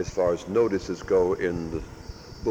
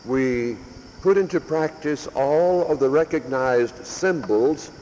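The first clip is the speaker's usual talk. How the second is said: forceful preaching; 4 semitones higher than usual. Real, not acted. Priest urging his parish to examine their Lent, preaching. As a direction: preach it out to the pews. Sermon builds to full force.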